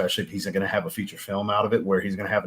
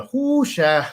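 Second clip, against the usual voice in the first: slow, drawn-out Cajun exclamation; Cajun Gambit; 13 semitones higher, 5 dB louder than usual. Shown as a demonstration. Showing how Gambit should sound, drawn-out Cajun accent. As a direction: stretch out "whoosha" in a Cajun accent. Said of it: "mixed the deeper voice into it of Gambit".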